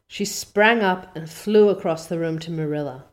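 ordinary delivery, nothing marked

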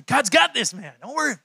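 high, punchy, emphatic reassurance; hearty encouragement. Shown as a demonstration. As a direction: belt it out to buck up a buddy. Shows hearty encouragement after whispered version.

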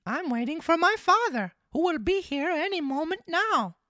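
very high, loud puppet voice; marionette voice. Acted. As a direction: pitch it way up as the naive little marionette. Pinocchio answers the fox.

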